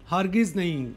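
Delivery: usual speaking voice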